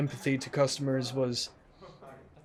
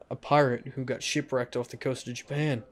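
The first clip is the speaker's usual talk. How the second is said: usual speaking voice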